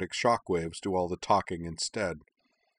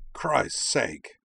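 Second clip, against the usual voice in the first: gravelly man voice; 4 semitones higher than usual. A narrator's reading. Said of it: "a gravelly voice said".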